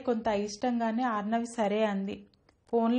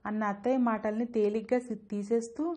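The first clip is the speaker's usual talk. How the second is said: ordinary delivery, nothing marked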